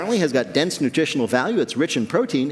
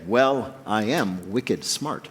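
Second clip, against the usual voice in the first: slow, softer, lightly proper delivery; mock Boston phrase, accent dropped; 6 semitones lower, 4 dB quieter, much slower than usual. Host mocking Bostonians who dropped their accent, slow and soft. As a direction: say it slowly with the Boston bite filed off. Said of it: "to say".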